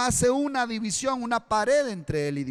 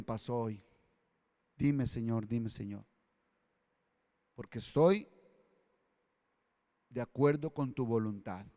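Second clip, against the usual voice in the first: much softer, lower, hushed and submissive; humble prayer; 7 semitones lower, 5 dB quieter than usual. Retold or imitated. Believer humbly asking God what to learn, soft and low. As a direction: pray gently, bowing to His will. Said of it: "Y usted con humildad le habla a Dios".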